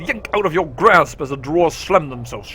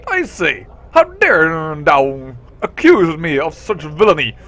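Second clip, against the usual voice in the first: loud, slow indignant British lady; outraged old British accent; 6 dB louder, slower than usual. Acted. Juliet scolds the accuser, indignant British accent. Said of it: "old British England accent".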